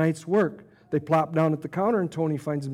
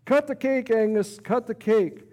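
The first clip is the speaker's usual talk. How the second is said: cheering yell; 6 semitones higher, slower than usual. Acted. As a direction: cheer her on at full voice. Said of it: "Everyone cheers and yells".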